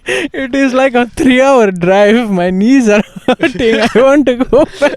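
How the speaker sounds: put-on high, laughing grandma with Indian accent; whiny grandma in an Indian accent. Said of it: "Do you wanna do it in her accent?".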